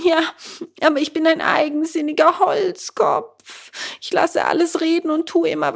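put-on high, loud, tearful boy's wail; wailing and lamenting. Bengele wails over his fallen schoolmate, high and tearful. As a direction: cry out in despair over a friend. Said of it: "weinte, jammerte und rief".

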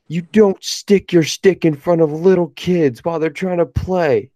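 imitating a kid's high, slow scolding; mock a little boy laying down the law, drawn out and high; high-pitched kid telling off an adult; indignant kid. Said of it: "he looks me dead in the eye".

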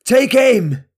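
high, loud military order; cried out. A narrator's reading. Madorn to his men with bows, cried out. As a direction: shout it as a command to archers. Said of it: "Madorn cried".

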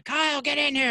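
high, loud mock mom holler; imitated yelling. Mom calling her son to the TV, imitated yell. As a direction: yell like a mother summoning her kid. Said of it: "So she's yelling".

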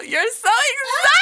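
high, very loud playful shriek; mock gushing shout. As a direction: gush over-the-top, shrieking it out. Hammed-up gushing at co-host.

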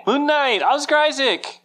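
high, loud fan cheer; excited shout. Real, not acted. Co-host joins in cheering the new trailer, excited shout. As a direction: echo the hype at full pitch. Cohost joins in hyped reveal.

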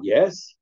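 YES lifted into a doubting question. Acted. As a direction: stretch YES upward at the end. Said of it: "he said, like, in a question mark".

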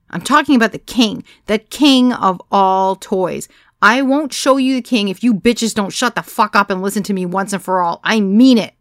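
loud, forceful commands; commanding. A narrator's reading. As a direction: take charge of the room. Commanding a rowdy room.

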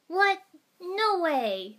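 high, loud startled denial; shocked high-pitched outburst; yelp. A narrator's reading. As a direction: yelp it out, outraged at the suggestion. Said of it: "Naruto yelped".

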